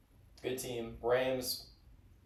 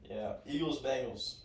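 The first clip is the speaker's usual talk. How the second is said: ordinary talk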